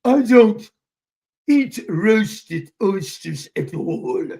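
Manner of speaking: slow, drawn-out mock British accent; Clever, British. Imitating Clever refusing roasted oysters, mock British. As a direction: imitate a haughty British refusal, loud start. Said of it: "she turned British on me".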